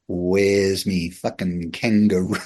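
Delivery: low, slow, quiet threat; drawn-out, softly menacing confrontation; retold menacing demand. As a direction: imitate a menacing older bloke, low and slow. Publican confronts kid who took kangaroo.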